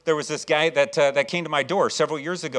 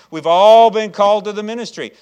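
higher, louder, rousing pulpit delivery; fervent preaching; 5 semitones higher, 12 dB louder than usual. Real, not acted. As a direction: preach it with full-force enthusiasm. Preaches on ministry.

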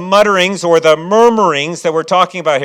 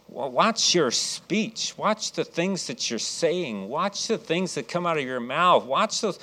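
quiet slow warning; 11 dB quieter, slower than usual. Acted. Pastor urging hearers to watch speech.

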